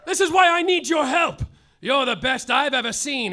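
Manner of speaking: normal talking voice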